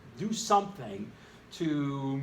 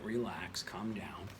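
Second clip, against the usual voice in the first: softer, lower soothing; mock calming; 6 semitones lower, 9 dB quieter, slower than usual. Teacher hushing his excited students, softer and lower. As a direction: settle the class down gently. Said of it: "teacher soothing his class".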